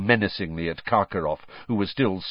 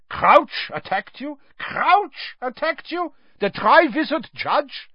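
very high, loud incredulous questions; shrieking incredulity; outraged disbelief; 4 dB louder than usual. A narrator's reading. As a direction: repeat it in scandalised disbelief.

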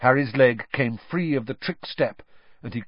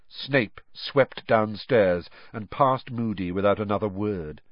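usual speaking voice

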